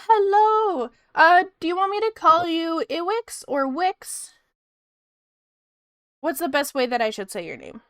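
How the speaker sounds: usual speaking voice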